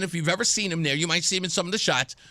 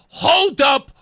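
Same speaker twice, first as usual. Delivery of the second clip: loud, high retold exclamation; stunned, shouted interjection; emphatic halt; 7 semitones higher, 7 dB louder than usual. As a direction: call a loud stop to everything. Retold stop-everything reaction.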